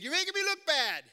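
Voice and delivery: mock-indignant yell at a young runner; retold yell. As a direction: shout it up the hill, half joking. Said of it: "And I yelled at him".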